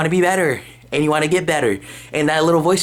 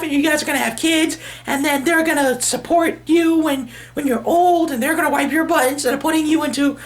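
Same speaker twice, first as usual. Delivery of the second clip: very high, giddy hype voice; cheerful inner voice; 14 semitones higher than usual. Retold or imitated. Upbeat inner voice hyping [name] after his workout, squeaky high cheer. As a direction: squeal it like an overexcited little cheerleader. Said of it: "sunshine in the back of my head that's going like".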